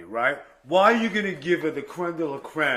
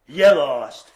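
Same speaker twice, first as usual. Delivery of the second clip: mock gloat; 10 dB louder than usual. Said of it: "start off the show with".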